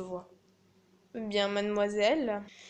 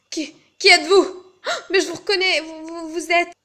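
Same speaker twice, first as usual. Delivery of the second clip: voix haute et forte, qui bafouille; admiratrice troublée; 6 semitones higher, 8 dB louder than usual. Acted. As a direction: jouer la surprise en bégayant un peu. Fausse admiratrice aborde l'artiste, troublée. (admiratrice troublée qui bégaie)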